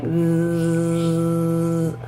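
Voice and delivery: buzzing then a curious what's that; teacher acting out a bee for the class; buzzing bee game. Shown as a demonstration. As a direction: buzz like a bee, then wonder aloud. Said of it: "you're like".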